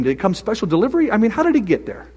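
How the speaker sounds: normal talking voice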